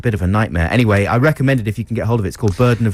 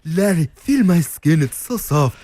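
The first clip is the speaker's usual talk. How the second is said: put-on higher, Austrian-accented coo; Schwarzenegger in Junior; 7 semitones higher than usual. Arnie line from Junior on request, Austrian accent. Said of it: "what about something from Junior?".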